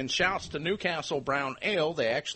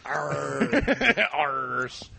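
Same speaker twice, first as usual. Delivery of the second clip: pirate; 3 dB louder than usual. Shown as a demonstration. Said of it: "I turn into a pirate when I say arse".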